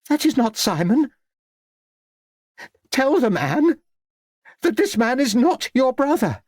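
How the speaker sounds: very high, insistent exclamation; shocked old lady. A narrator's reading. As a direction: exclaim in a high, appalled lady's voice. Shocked aunt denies the corpse's identity.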